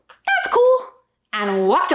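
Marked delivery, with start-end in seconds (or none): 0.1-0.8 put-on high, light child's voice, much higher; impressed little girl. Daughter reacting to the man's leg, high and casually impressed. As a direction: toss it off like a satisfied little kid.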